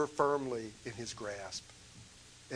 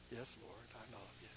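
very soft, almost fading away; quiet, humble answer; hushed reply; 4 semitones lower, 17 dB quieter than usual. Acted. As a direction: answer barely above a breath. Peter's soft reply, retold.

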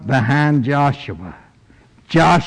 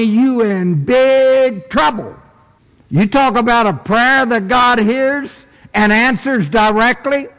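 high, ringing pulpit delivery; preaching at full force; 9 semitones higher than usual. Acted. Preacher answering feelings of inadequacy with scripture, preaching at full force. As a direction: drive each phrase home from the pulpit.

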